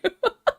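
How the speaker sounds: short high giggle; high laugh. Real, not acted. Streamer laughing at a cheeky chatter, high giggle.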